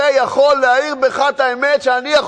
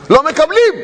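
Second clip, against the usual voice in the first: emphatic shout; 6 semitones higher, 4 dB louder than usual. Acted. Preacher repeats a claim.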